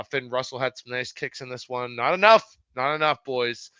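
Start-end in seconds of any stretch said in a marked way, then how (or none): 1.9-2.6 exclamation. Real, not acted. Lament over Finn Russell's kicks.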